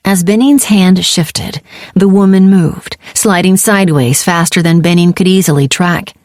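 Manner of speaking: ordinary delivery, nothing marked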